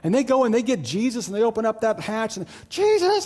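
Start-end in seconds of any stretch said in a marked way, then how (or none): 2.7-3.2 very high, loud call to wake someone; frantic yell. Acted. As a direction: yell down the hatch in alarm. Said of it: "They're yelling".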